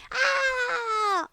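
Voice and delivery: high falling scream; scream of fright. A narrator's reading. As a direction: shriek as the ground drops away. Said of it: "Arthur screamed".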